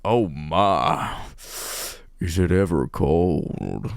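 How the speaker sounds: low, slow, drawn-out sultry line; found seductive voice. Acted. Heroine finding her coached flirting voice, mock-sultry. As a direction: sink into a hammed-up purr. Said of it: "Oh, here it is".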